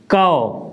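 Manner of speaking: कौ said as "kau"; teacher sounding out "कौ"; ka with au matra. Shown as a demonstration. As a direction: model "कौ" clearly. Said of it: "तो हम इसको पढ़ेंगे".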